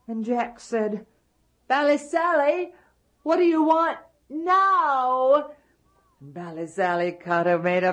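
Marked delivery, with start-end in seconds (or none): 1.7-5.5 put-on higher child call; boy calling up. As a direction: call up to the giant. Boy calls up to giant.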